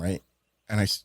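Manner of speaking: usual speaking voice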